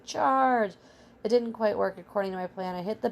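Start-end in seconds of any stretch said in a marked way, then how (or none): 0.0-0.7 high yell, drawn out and falling; hammed-up charging cry; yelled battle cry. A narrator's reading.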